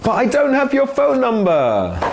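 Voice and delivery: high, pleading cry; begging before they leave; last-ditch whine for a number; desperate plea. Acted. Desperate role-play plea.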